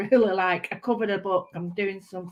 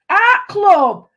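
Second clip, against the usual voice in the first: excited cheer; 10 semitones higher, 12 dB louder than usual. Real, not acted. Excited greeting of art club.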